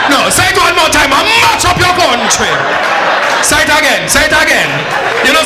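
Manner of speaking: mock furious president. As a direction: yell threats through laughter like a mad leader. President yelling at Russia.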